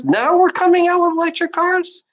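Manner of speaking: very high-pitched, incredulous repeat; incredulous outburst. Real, not acted. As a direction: push the pitch way up in outraged disbelief.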